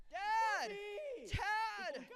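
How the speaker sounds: put-on high child's voice calling for his dad; boy calling out. Bound son calling to his father, high child's call. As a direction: call out as a small boy who can't see his father.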